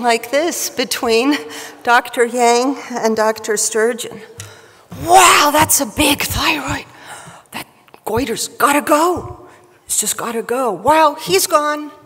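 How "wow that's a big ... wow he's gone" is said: mostly higher, hammed-up surgeon banter; goiter whisperer voice. Speaker acts two doctors marvelling at a big thyroid, in a goiter whisperer voice. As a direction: put on a comic goiter-whisperer character. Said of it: "in my best goiter whisperer voice".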